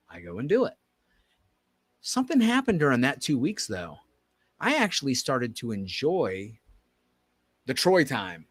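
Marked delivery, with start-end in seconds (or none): none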